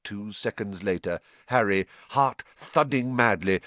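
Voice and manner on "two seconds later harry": plain reading voice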